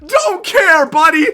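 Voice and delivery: very high, loud screams of joy; overjoyed shrieking at a huge trade; ecstatic screaming. Real, not acted. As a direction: scream like you just won the championship. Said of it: "a grown man screaming at the video game".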